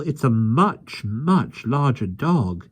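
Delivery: put-on slow boyish voice, drawn out; boy Michael. A narrator's reading. Michael tells the keeper, slow boyish voice. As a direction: stretch it out like a small boy.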